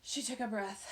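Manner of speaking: ordinary delivery, nothing marked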